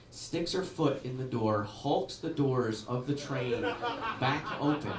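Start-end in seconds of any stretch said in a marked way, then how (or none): none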